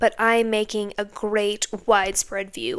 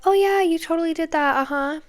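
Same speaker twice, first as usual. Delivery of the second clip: breezy receptionist; 6 semitones higher than usual. Retold or imitated. Radiology staffer assuring a patient on the phone, high and breezy. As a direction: imitate an airy, higher-pitched staffer brushing off a worry.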